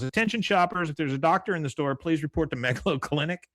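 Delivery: lightly put-on PA call to shoppers; store PA announcer. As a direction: announce it as if over the store tannoy. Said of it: "gets on the phone for the P A".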